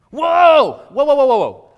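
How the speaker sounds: loud, high startled whoa; shocked whoa. Acted. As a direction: cry out in alarmed disbelief. Disciples' shocked reaction voiced.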